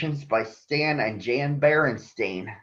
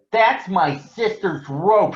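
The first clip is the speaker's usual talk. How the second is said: slow, loud, higher protest; shouting; 7 semitones higher, 5 dB louder than usual. Acted. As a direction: shout it at the bully. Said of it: "shouted Brother".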